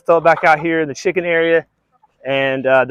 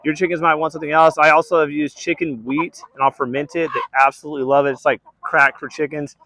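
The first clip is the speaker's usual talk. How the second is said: ordinary talk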